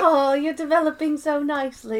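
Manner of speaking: high-pitched mocking coo; mean teasing. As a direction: imitate someone cattily teasing. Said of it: "she says something mean".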